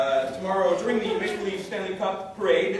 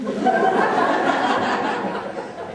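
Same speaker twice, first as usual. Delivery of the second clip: bursting out laughing at his own joke; loud laugh, breathless; real laughter; 9 dB louder than usual. Real, not acted.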